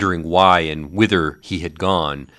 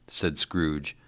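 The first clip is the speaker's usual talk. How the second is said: ordinary delivery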